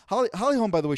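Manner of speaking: ordinary talk, nothing marked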